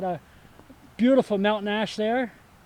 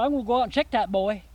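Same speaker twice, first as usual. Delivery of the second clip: light mock Southern accent; 3 semitones higher than usual. Retelling the visitor's decision to check the sign, mock Southern accent. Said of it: "he had a southern accent".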